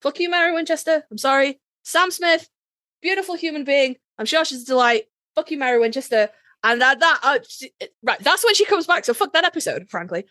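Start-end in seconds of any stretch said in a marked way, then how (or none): none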